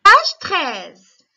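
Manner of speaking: calling out the page loudly to the class; loud announcement. Real, not acted. Teacher to pupils, loudly calling out the page. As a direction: raise the voice to get the class's attention.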